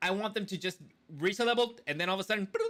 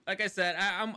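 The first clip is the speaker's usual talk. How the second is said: ordinary talk, nothing marked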